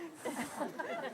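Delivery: soft giggle behind the words; breathy laugh. Real, not acted. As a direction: laugh at her own joke. Laughing at her own joke.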